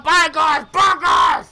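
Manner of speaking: loud, high shout of the name; excited shout. Real, not acted. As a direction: yell the name at the screen. Begging gacha for Bygars.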